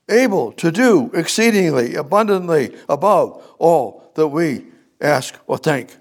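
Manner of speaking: high, loud peak of the verse; climax of the verse. Shown as a demonstration. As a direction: hit the top of the build. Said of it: "I'm gonna give it to you differently".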